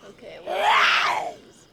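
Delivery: frantic yelled question; panicked shout. Real, not acted. Panic searching shoes.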